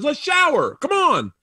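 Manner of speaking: high-pitched retold urging; calling out. Comedian acts [name] urging him along, calling out.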